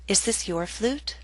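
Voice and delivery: "Is this your flute?" rising on "flute"; rising intonation. Shown as a demonstration. Teacher modelling a yes-no question, rising intonation. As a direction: softly lift the pitch at "flute". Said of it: "yes-no questions use rising intonation".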